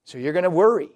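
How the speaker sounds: loud, forceful proclamation; stirred-up preacher raising his voice; preaching shout. Real, not acted. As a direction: call it out to the whole room. Preacher building to a climax.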